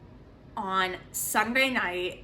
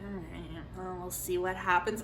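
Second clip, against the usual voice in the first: skeptical acceptance; 5 dB quieter than usual. Retold or imitated. Retells her skeptical reaction to advisor.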